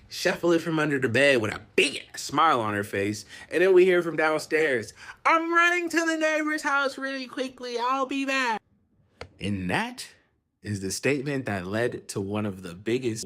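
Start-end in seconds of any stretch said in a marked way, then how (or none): 5.2-8.6 high sing-out from another floor; mom calling out. Retold or imitated. Girl's mom calls up from downstairs, high-pitched calling out. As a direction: call it out high to kids upstairs.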